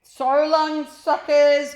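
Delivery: very loud, high taunting yell; boy calling out. Acted. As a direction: jeer down at the caged grown-ups. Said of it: "called out the boy".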